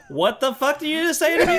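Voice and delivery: mock angry Texan. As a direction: play an offended Texan, half laughing. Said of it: "Texas is like".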